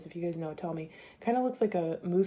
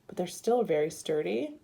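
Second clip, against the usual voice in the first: normal talking voice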